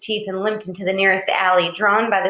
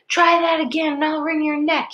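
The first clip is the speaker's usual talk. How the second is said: snarling threat; 7 semitones higher than usual. Acted. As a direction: threaten him like you mean it. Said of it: "he snarled".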